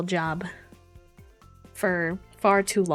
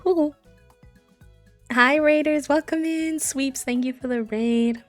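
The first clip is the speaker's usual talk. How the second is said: cute anime streamer; 7 semitones higher, 4 dB louder, slower than usual. Real, not acted. Greeting incoming raiders excitedly.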